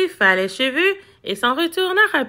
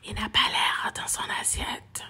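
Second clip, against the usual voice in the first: hushed, fully whispered aside; 6 semitones lower, 11 dB quieter than usual. A narrator's reading. Cat confiding about the boy, whisper. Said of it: "Frime chuchota".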